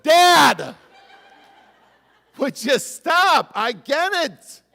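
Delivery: loud, high mock-exasperated shout at a pest; exasperated yell. Pastor voicing his dad's teasing, shouting high and loud. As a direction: yell at him like a fed-up kid, half laughing. Exasperated daughter yelling at her dad.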